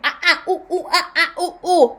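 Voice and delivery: high hooting ape calls; ape noises. Acted. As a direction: hoot oohs and ahs like an ape. Imitating apes pointing, no words.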